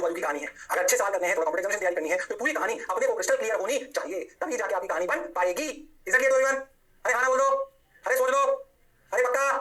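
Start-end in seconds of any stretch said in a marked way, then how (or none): 6.1-9.5 higher, somewhat louder prodding questions; calling out checks. Real, not acted. Teacher asks everyone if it is clear, calling out. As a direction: prod the room for an answer.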